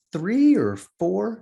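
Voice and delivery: high, very slow, questioning; unsure guess. Retold or imitated. Mother guessing how many children she has, high and hesitant. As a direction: draw each word up into a tentative question.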